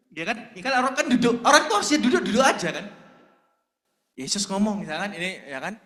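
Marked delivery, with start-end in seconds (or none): none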